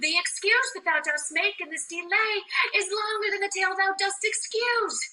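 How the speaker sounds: high, insistent complaint; impatient. Acted. Juliet scolding nurse for delaying news, impatient. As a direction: argue back in exasperation.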